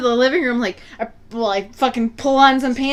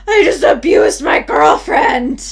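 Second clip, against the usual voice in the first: put-on slow, loud drunk wail; mock a drunk bawling out a confession; imitated drunken sobbing; 3 semitones higher, 6 dB louder, slower than usual. Boyfriend confessing on the stairs, imitated drunken sobbing. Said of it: "like, sobbing drunkenly on the stairs".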